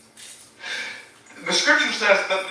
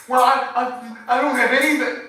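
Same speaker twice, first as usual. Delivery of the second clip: mock complaining listener; 8 semitones higher than usual. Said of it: "If you think".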